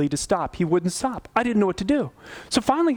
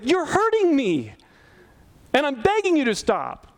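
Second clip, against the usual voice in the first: high, stretched, anguished retold plea; hurt begging; 10 semitones higher than usual. Pastor begging the calm accuser to stop, hurt. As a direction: plead from hurt, pitch soaring. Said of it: "I'm getting louder and more hurt by this".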